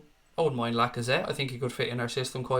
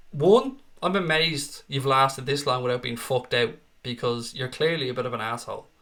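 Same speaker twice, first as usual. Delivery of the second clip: ordinary talk, nothing marked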